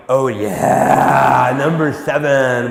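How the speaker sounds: slow, smug, play-it-cool nod; mock cocky bluff. Pitcher bluffing the catcher's seventh sign, slow and smug. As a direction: fake total confidence, drawn out.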